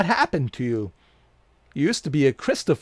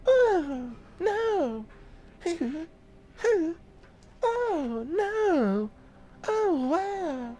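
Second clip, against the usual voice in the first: much higher, giggling through the words; squealing laughter; 14 semitones higher, 4 dB quieter, much slower than usual. Real, not acted. Streamer cracking up at the game, squealing high.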